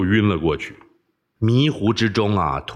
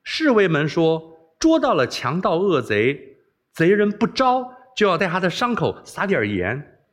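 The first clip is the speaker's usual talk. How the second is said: teasing laugh; 7 semitones higher than usual. Acted. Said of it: "笑道".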